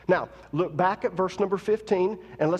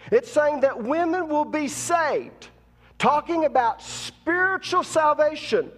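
full-force preaching; 6 semitones higher than usual. Acted. Preacher driving home salvation to congregation, full-force preaching.

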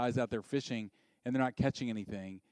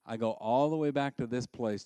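ordinary talk

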